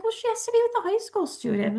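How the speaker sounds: quick, high mock-breezy brush-off; dismissive brush-off. Mom mocking the other mom's careless excuse in a quick breezy voice. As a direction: toss it off as if it's nothing. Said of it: "the other mom is just like".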